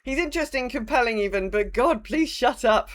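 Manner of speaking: normal talking voice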